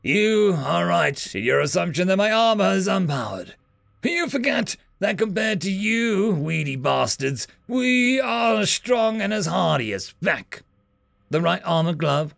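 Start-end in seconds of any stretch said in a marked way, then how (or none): 0.0-10.6 wounded soldier. Acted. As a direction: needle him while pinned. Soldier explains before killing.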